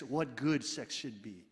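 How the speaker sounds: ordinary talk, nothing marked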